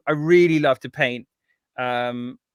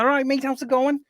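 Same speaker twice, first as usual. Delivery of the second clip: higher-pitched Scouse impression; Scouse accent; 9 semitones higher than usual. Shown as a demonstration. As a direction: put on a Liverpool accent. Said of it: "can you do it?".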